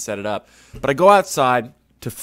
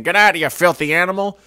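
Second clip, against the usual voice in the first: louder, mock movie-line delivery; talk boy recording; 5 semitones higher, 6 dB louder than usual. Host imagines his talk boy scaring off the cat, mock yelling. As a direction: bark it like a booby-trap recording. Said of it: "the talk boy plays a thing".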